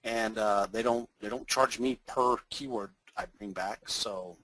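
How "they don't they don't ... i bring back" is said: ordinary talk, nothing marked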